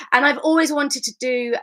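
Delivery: ordinary delivery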